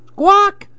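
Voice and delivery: shrill high squawk like a startled parrot. A narrator's reading. Parrot screams at the talking tree, high squawk. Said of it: "screamed the parrot".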